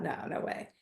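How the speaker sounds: hushed unvoiced "No, no way"; whispered thought. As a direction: breathe it as a private doubt.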